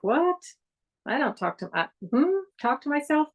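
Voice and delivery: high, incredulous "what?"; a mock baffled listener voice; mock surprise. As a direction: play the baffled skeptic, pitch up.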